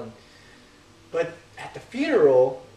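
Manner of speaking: ordinary delivery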